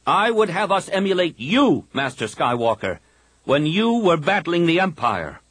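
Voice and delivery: Kip's fervent voice. A narrator's reading. Kip urging the Jedi council to fight, insistent. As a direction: preach the fight, every word pressed.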